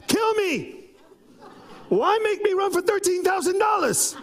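high pitched, half laughing protest shouted upward; exasperated outcry to God. Real, not acted. Alone in his office, pastor cries out to God, high and half-laughing. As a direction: cry out to God in disbelief, laughing at the absurdity.

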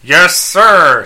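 loud, high robot helper; Skeets. Acted. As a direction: answer promptly as an eager robot servant. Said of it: "Pretty good, Skeets".

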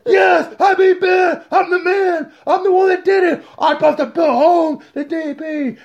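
high, gushing brag; mock giddy Fury. Mocking Fury telling Chris about beating Ben, high giddy brag. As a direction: squeal the news like a kid.

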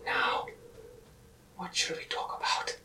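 hushed, airy villain menace; creepy Todd impression. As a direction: breathe it out like a smiling torturer. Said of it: "my Todd impression from Raiders of the Lost Ark, the creepy line".

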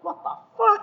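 very high squeaky incredulous yelp; mock disbelief. Replying to the old man's question, squeaky disbelief. Retold reaction to old man.

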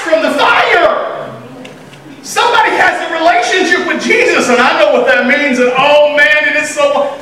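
hollering praise at a new believer; excited church cheer. Pretend. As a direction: cheer like someone just got saved. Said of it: "people get saved and we go".

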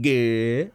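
"g" held as a long "guh" sound; imitated drawn-out "g". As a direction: tack on a stretched "g", no final L. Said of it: "like that".

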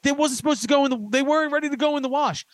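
high, whiny mock protest; protesting excuse. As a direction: plead your case like a wronged kid. Retells pleading excuse to his dad.

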